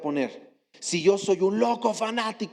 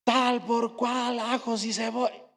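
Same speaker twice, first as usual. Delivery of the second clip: teacher swearing; 7 semitones higher, slower than usual. Retold or imitated. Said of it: "y el profe".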